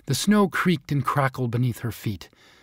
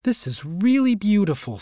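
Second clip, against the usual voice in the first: put-on lightly raised young girl voice; a hint of an admiring young girl; girl's voice; 7 semitones higher than usual. A narrator's reading.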